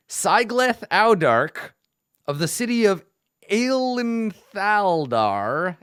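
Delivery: slow, searching read of hard fantasy names; careful pronunciation. Shown as a demonstration. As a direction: sound out "Sigleth Audark" and "Aelinthaldar" very slowly, syllable by syllable. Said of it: "let me see if I can pronounce these names".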